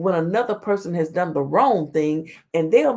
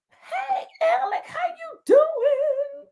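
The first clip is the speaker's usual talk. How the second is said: high squeaky drawn-out hello; falsetto greeting; much slower than usual. Real, not acted.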